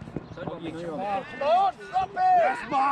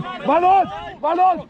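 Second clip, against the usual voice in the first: shouting; 3 semitones higher, 7 dB louder, slower than usual. Real, not acted. Coach warning of opponent.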